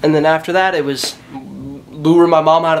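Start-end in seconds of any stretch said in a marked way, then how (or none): none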